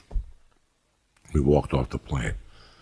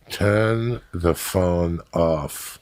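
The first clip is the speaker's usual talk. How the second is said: put-on very slow, drawn-out German accent; imitated German accent, ponderously slow; much slower than usual. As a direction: drag out each word like a stern German. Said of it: "A German guy".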